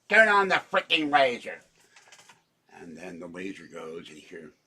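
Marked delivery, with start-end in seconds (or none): 0.1-1.6 shouted Dr. Evil line, higher and louder; mock villain yelling at his henchmen; Dr. Evil quote. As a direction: bark the order like Dr. Evil. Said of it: "Or should he say".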